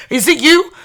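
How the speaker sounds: sudden high, loud squeal; hammed-up overeager squeal; mock excited shriek. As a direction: blurt it out like you've spotted the one. Mocking overeager seeker.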